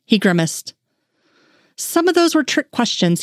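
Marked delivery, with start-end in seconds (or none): 1.8-3.2 higher, defensive protest; he insists it wasn't his fault; insisting. Acted. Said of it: "he insisted".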